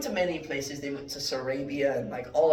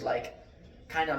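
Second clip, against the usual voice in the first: usual speaking voice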